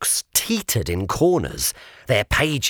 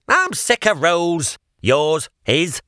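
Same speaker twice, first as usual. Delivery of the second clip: a lightly voiced sulky pig, a bit louder; 7 semitones higher, 3 dB louder than usual. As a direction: grumble like a fed-up youngster. The pig sulks at Sir Regulus.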